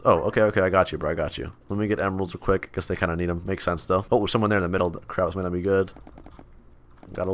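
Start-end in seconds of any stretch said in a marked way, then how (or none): none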